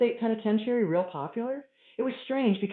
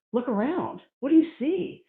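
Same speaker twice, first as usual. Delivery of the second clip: higher-pitched questioning voice; mysterious voice; 7 semitones higher than usual. Acted. Voice speaking to him in the hotel, raised probing tone. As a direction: pose it as a voice out of nowhere. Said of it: "a voice said to me".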